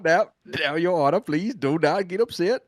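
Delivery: laughing mock southern lawyer; a hint of a pleading southern attorney; southern accent. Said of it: "if I talk like this".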